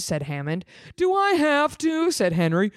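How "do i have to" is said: much higher whiny plea; whiny brother. Acted. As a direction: whine like a reluctant little brother. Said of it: "They sound very brotherly".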